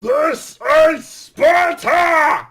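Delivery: drawn-out, high and very loud war cry; battle yell. Retold or imitated. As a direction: bellow it like Leonidas. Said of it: "And he yells".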